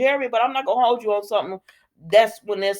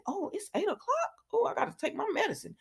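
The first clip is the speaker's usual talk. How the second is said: high, soft, hurried aside; suddenly remembering the time; sudden realization; 11 semitones higher, 12 dB quieter than usual. Real, not acted.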